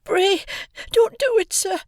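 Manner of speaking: put-on high, terrified boy's plea; pleading in terror. A narrator's reading. Small boy begging the convict not to kill him, high terrified plea. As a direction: beg for your life like a frightened child. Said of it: "I pleaded in terror".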